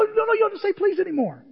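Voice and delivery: high-pitched retold protest; hammed-up high voice; mock flustered protest. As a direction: squeal the reassurance in mock desperation. Acting out his flustered reply.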